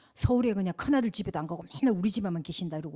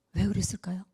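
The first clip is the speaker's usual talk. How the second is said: softer echo; 3 semitones lower than usual. Real, not acted.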